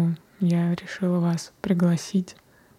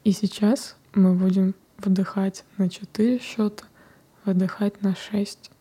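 whispered meditation guidance; guided meditation. Acted. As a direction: guide them gently into the breath. Leads a breathing meditation.

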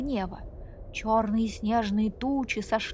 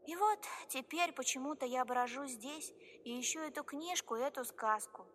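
тонкий, тихий голосок маленькой девочки; говори тихо и робко, как малышка; тихий детский голос; 3 semitones higher, 11 dB quieter than usual. Acted. Маленькая Нана рассказывает. (тихий робкий детский голосок)

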